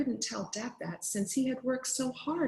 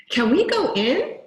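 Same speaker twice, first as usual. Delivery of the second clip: higher, louder eager question; eager kid asking; 7 semitones higher, 8 dB louder than usual. Acted. Kid begging his new friend to enter, eager. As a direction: ask hopefully to be let in. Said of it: "he asked".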